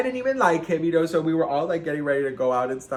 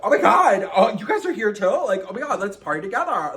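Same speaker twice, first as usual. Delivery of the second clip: high, loud party gushing; overexcited act; 7 semitones higher, 7 dB louder than usual. Retold or imitated. Faking delight to the ex's group, overexcited. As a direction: gush at full tilt, faking delight. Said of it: "I, I was on ten".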